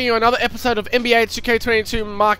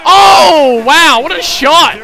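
excited shout; 6 semitones higher, 6 dB louder, slower than usual. Real, not acted. Teammate hits a big three.

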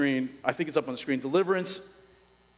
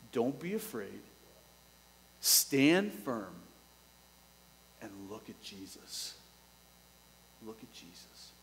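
fading to a soft near-whisper; God speaking softly to his people, fading away; gentle hush; 6 semitones lower, 7 dB quieter than usual. Acted. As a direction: draw them in quietly at the end. God's gentle command.

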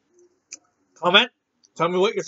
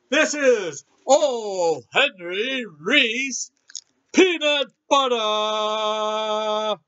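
slow announcement; 2 semitones higher, much slower than usual. Acted. Announcing the product name.

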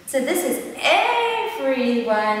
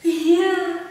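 ordinary delivery

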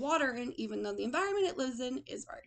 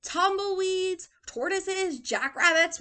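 high, loud, indignant listing; exasperated; 8 semitones higher, 7 dB louder, slower than usual. A narrator's reading. Hank lists his unwanted visitors, exasperated. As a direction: rattle off each pest, raised and drawn out.

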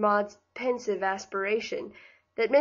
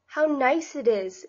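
slow, delighted exclamation; excited exclaim; 4 semitones higher, slower than usual. Acted. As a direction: gush over the room with delight. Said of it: "exclaimed Maud".

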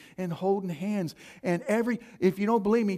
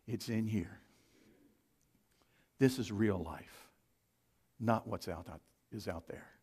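much softer, lower intensity; quiet and low; 7 semitones lower, 8 dB quieter than usual. Real, not acted. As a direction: pull the room in quietly. Preacher drops voice low.

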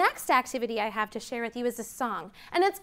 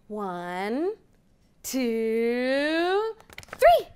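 building countdown; much slower than usual. Acted. Teacher counting to children, building to an excited three.

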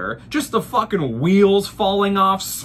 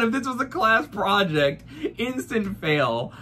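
normal talking voice

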